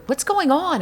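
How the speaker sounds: high-pitched startled question; reliving a jolt of alarm. Retold or imitated. Woman recalling waking in the earthquake, alarmed.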